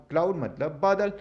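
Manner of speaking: ordinary delivery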